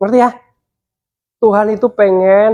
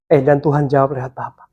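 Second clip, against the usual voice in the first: normal talking voice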